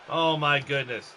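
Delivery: ordinary talk, nothing marked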